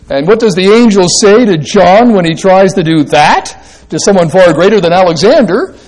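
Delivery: normal talking voice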